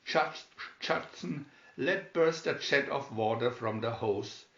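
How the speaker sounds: usual speaking voice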